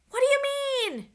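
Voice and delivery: high, loud shouted question; shouting above wind. A narrator's reading. Jack shouting to Morgan over the rising wind, high and loud. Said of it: "shouted Jack".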